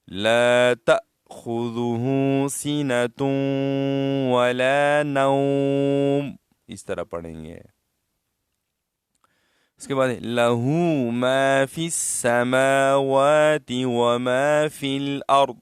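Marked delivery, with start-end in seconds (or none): 0.1-6.3 slow, louder tajweed chant; Quran recitation. Acted. Recites the verse. 10.1-15.5 slow, louder tajweed chant; Quran recitation. Acted. Recites the verse.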